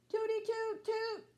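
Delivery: high, toy-trumpet tooting; trumpet toots. Acted. Bean tooting his trumpet at night, high mock horn. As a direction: toot it like a little horn.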